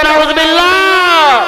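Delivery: drawn-out, slow cry of nauzubillah; shouted exclamation. Shown as a demonstration. Preacher showing the crowd how to cry out, slow and drawn out. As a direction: call it out slowly for the crowd to echo. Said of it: "চিৎকার দিয়ে বলতে হবে".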